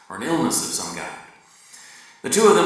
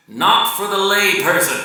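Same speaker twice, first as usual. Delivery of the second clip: high, loud proud declaration; the husband boasting about their game, loud and high; quoting the man; 10 semitones higher, 7 dB louder than usual. Acted. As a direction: announce it like a boast. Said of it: "was what this man said".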